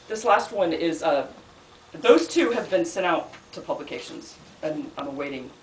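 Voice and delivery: ordinary talk, nothing marked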